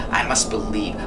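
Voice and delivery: ordinary delivery, nothing marked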